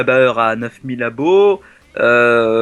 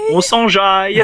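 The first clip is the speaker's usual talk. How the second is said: high, loud, hyped; excited catchphrase shout; 6 semitones higher, 4 dB louder, slower than usual. Pretend. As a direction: belt the catchphrase with glee. Repeats catchphrase louder, higher.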